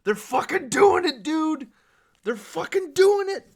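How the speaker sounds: high, loud jubilant shouting; real excitement. Real, not acted. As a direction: shout it like news too good to believe. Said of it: "I'm so excited".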